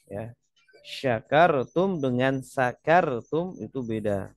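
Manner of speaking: usual speaking voice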